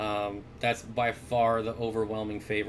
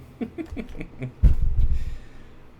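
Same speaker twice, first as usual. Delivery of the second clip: laughing; 9 semitones higher, 7 dB louder than usual. Real, not acted. Laughing at own joke.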